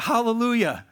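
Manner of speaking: Hallelujah called out high and loud again; crying out. Acted. Preacher reading the second cry of the multitude, loud proclamation. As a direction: ring it out like a crowd praising. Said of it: "Once more they cried out".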